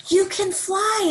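high, drawn-out delighted exclamation; thrilled, high-pitched and stretched; joyful cry. Acted. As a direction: cry out in storybook amazement. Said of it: "Izzy cried".

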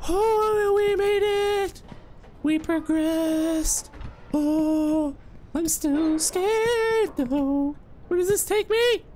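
very high, stretched-out squeal of nerves; slow, drawn-out words far up in pitch; voice climbing high. Real, not acted. Player talking to himself after making a scary jump, squeaky high. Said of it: "my voice is progressively getting higher".

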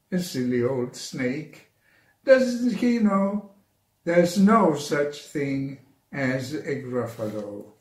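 plain reading voice

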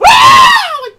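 sudden high piercing shriek of fright; startled scream. Real, not acted. Gamer shrieks at a jump scare, loud startled scream. Jumpscare while playing the game.

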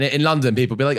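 usual speaking voice

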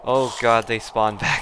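loud alarmed outcry; alarmed outcry as zombies respawn; being chased. Real, not acted.

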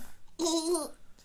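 high airy little laugh; a quick childish giggle on request. Acted. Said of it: "How does she laugh?".

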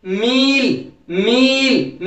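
"मील" with a stretched ee; long E. Shown as a demonstration. Teacher drills capital E, long "मील". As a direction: draw out the middle of "मील". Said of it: "लंबा pronounce कर रहे हैं".

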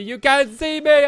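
loud, slow wrestler catchphrase; John Cena taunt. Pretend. Gamer taunting mobs, loud slow catchphrase.

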